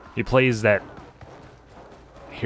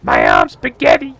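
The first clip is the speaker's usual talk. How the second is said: loud, punched rapper mimicry; weird rapper voice; 11 semitones lower, 7 dB louder than usual. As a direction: belt it out as an odd-voiced rapper. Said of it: "like, a weird voice for rapping".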